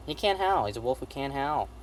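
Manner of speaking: ordinary talk, nothing marked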